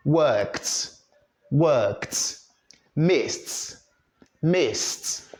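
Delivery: "worked" and "missed" closed with a "t"; voiceless T ending. Shown as a demonstration. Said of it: "the ending as t".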